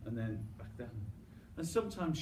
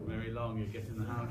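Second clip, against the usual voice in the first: usual speaking voice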